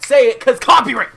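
high, loud joking yell; excited shout. Real, not acted. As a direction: shout it out gleefully.

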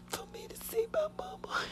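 breath-only crying whisper, barely audible; real tears, all air and no voice; whispered sob. Real, not acted. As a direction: choke the words out in a sob. Weeping recalling mother's body.

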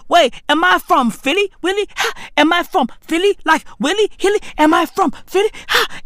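high-pitched, stuttering bafflement; confused repetition. Acted. Comedian asking if he is from Philly, high-pitched confusion. Acting confused on hearing the accent.

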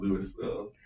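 "Louisville" said fully as Lewis-ville; favoured pronunciation. Shown as a demonstration. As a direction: pronounce the S in "Louisville". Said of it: "Personally, I'm a fan of".

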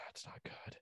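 a quick breathy aside with no voice; whisper. Real, not acted. Muttered aside.

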